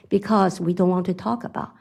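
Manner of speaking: ordinary talk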